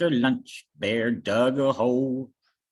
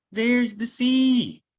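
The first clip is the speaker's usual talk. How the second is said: excited; 10 semitones higher, 5 dB louder than usual. Acted. Bear excitedly sees the sea.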